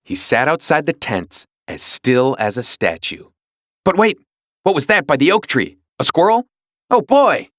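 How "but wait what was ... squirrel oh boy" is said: high, rising excitement; eager dog losing focus; excited dog. Acted. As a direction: get carried away by the squirrel. Dog spots a squirrel.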